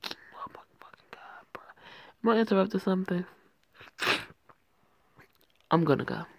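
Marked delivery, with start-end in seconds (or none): 0.1-1.6 barely audible whispered aside; whispered exasperation. Real, not acted. Reader muttering about the story, whispered exasperation. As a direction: whisper it to yourself in disbelief.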